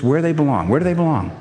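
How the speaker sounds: ordinary talk, nothing marked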